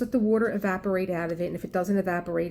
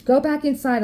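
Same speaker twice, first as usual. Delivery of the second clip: louder, higher sharp command; ordering; 6 semitones higher, 6 dB louder than usual. Retold or imitated. To the neighbor's son, a loud sharp order. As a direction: snap the order out.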